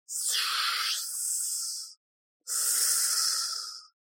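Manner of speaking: long voiceless serpent hiss; creature hissing. A narrator's reading. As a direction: hiss softly, stretching each sibilant. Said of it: "шипело неизвестное существо".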